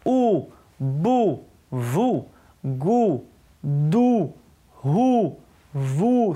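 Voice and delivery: long, drawn-out oo on each syllable; slow teacher's reading drill. Shown as a demonstration. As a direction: recite each syllable slowly, separated by pauses. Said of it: "את הצליל הזה".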